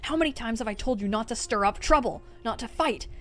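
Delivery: escalating anguished tirade; furious snarl. A narrator's reading. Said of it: "snarled at him".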